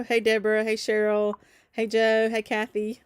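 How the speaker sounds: ordinary talk, nothing marked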